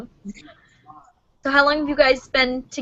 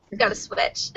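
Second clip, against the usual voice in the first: whisper; 3 semitones lower, 7 dB quieter than usual. Retold or imitated. Whispered end of the quote.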